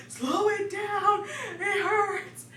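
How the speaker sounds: high, stretched-out pained wail; retold yelling. Wife in contractions to husband pushing her, retold yelling. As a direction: complain in a high, slow mock yell. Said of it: "I'm yelling at my husband".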